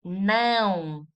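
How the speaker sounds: "Não" held long with a nasal diphthong; nasal tilde sound. Shown as a demonstration. Teacher contrasting it with now, long nasal "Não". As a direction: push "Não" through the nose, drawn out. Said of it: "is with the tilde".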